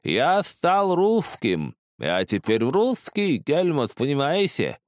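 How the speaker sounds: high, slow, exultant cackling voice; crazed glee. A narrator's reading. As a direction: proclaim it loudly, stretched in mad delight. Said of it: "Кестер расхохотался". (безумное ликование, протяжно и высоко)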